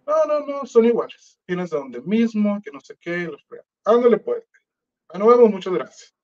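host mockingly sending the rapper off. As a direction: parody the host who dismisses you. Host scolding him, then brushing off.

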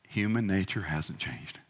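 ordinary talk

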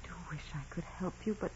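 soft, fast whisper; gentle whisper. Acted. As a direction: murmur it kindly under your breath. Gentle reply to desperate nun.

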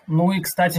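usual speaking voice

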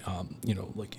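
normal talking voice

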